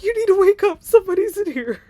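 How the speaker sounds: loud, high, urgent warning; panicked shout. Retold or imitated. Girl warning her cousin someone's inside, panicked shout. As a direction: yell it like there's an intruder.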